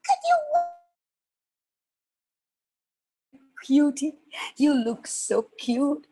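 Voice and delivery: squeaky little creature voice; cute fox. Acted. First fox meeting the little girl, cute. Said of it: "the first fox said".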